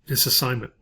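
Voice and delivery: usual speaking voice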